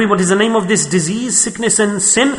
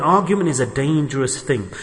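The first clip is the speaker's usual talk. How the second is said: normal talking voice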